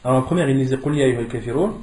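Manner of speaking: normal talking voice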